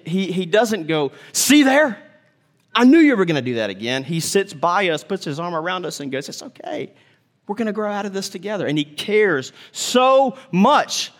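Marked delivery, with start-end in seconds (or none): 1.3-4.0 smug scolding, fast; snippy I-told-you-so; mock told-you-so. Voiced God scolding a sinner. 6.3-8.7 whispered, soft and quick; hushed reassuring voice; gentle reassurance. Retold or imitated. God comforting, arm around us.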